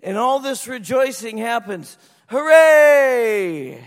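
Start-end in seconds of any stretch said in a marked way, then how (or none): 2.3-3.8 loud joyful hooray, thrown up high; cheer. Retold or imitated. Congregation cheers the lost boy found, loud celebratory cheer. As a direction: shout it like the whole room is celebrating. Crowd rejoicing Zach is found.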